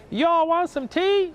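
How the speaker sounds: slow it down like a Southern host; mock Southern US accent. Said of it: "a very different accent".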